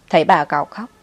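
plain reading voice

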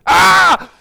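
sudden high angry yell; angry gamer shout. Pretend. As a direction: burst out like a furious gamer. Enojo al jugar videojuegos. (grito de rabia de jugador)

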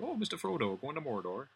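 high, soft West Country hobbit; West Country accent. Retold or imitated. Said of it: "hobbits in Lord of the Rings talk with a West Country accent".

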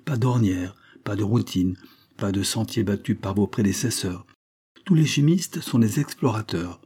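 ordinary delivery, nothing marked

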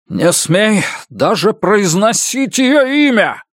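high, slow menacing shout; furious. Acted. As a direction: threaten him through rage. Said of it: "злобно уставился на Баэля".

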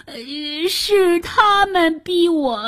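high, drawn-out quavering plea; cowardly minister pleading for his life; trembling with fear. A narrator's reading. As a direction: grovel in a high, slow, frightened voice. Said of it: "哆哆嗦嗦地跪在地上说".

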